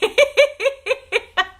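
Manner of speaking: a squealing giggle; high delighted laugh. Real, not acted. Reacting to a sweet comment from Stephanie, giggling.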